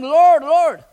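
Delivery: a tearful pleading Lord, Lord; crying out. Retold or imitated. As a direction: cry out to God from your knees. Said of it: "crying like a baby on the floor".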